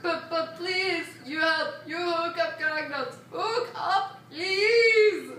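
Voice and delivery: lightly voiced grumpy old man; a hint of a pleading old man. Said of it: "your old man voice".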